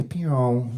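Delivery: soft, low, drawn-out "pew"; lazy laser sound. Retold or imitated. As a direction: let the zap slide down slowly. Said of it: "that's the sound of a lazy laser".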